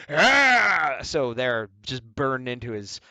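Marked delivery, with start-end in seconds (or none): 0.1-1.0 high loud mock "Ah!" shout; villain shout. Artist imitating the villain's shout, loud and high. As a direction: bark out a villain's cry. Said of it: "he's always like".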